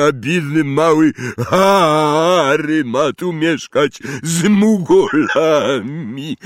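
high, loud, slow wailing giant; blubbering half-giant in grief; sobbing giant. A narrator's reading. Hagrid weeping, voiced in story.

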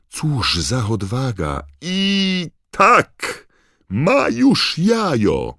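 very high, loud shouts climbing to a triumphant shriek; Bagman's excited commentary. A narrator's reading. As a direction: commentator going wild at the winning grab. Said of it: "zawołał Bagman".

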